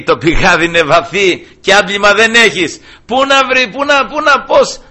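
hammed-up puzzled Samaritan woman; baffled woman. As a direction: stammer in mock disbelief. Woman baffled about the deep well.

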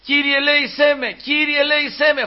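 high, repeated plea for mercy; acted high-pitched pleading cry; imitated crying out. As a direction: call out high like a desperate supplicant, without full volume. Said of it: "φώναζε".

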